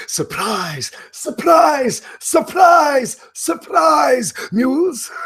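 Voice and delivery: high, shouted gloating surprise, surprise; mock shout. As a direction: crow it out at full volume. Character gloats over upset win.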